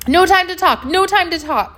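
high, loud, urgent refusal; hurried brush-off. A narrator's reading. Dwayne brushing off a question while running, hurried. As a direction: call it out on the run. Rushing past, refusing to stop.

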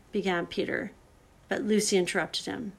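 usual speaking voice

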